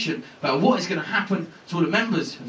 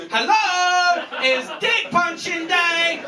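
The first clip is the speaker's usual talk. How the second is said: high, sing-out announcement; drawn-out, high-pitched yell; gleeful shout; 11 semitones higher, 3 dB louder, much slower than usual. Acted. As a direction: holler it like a long-awaited big day. Puncher announces himself at door.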